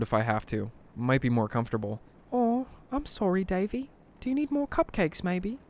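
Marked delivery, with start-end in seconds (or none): 2.3-5.6 put-on high-pitched English old-lady voice; sweet English granny, pitched high. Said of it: "She's originally from England".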